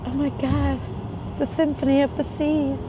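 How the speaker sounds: real flustered excitement; excited, flustered. Real, not acted. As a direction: gush in awe, pitch held up. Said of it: "I'm gonna get a little bit excited".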